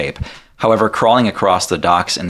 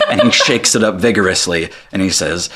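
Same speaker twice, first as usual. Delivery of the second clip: ordinary delivery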